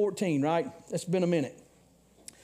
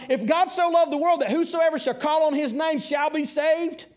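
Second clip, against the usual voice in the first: fiery preaching; 6 semitones higher than usual. Acted. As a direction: build it up like a revival pulpit. Preaching at full force.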